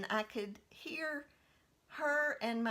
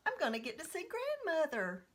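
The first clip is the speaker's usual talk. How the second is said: higher, quick, eager; excited child; 9 semitones higher than usual. Retold or imitated. Little girl as the box opens, excited child voice. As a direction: think it like a thrilled little girl.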